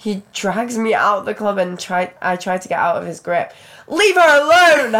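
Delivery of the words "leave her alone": loud slow shout. Acted. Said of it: "Harry shouts".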